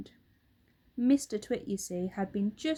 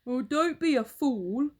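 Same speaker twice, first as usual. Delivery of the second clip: Mister Twit; 4 semitones higher, 6 dB louder than usual. Acted. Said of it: "Mister Twit said".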